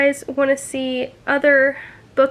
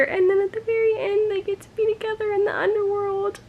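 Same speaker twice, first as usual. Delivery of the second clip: higher and quicker, voice breaking with tears; tearful; 6 semitones higher, 4 dB quieter, faster than usual. Real, not acted. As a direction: rush through it before crying. Tearful recap of the ending.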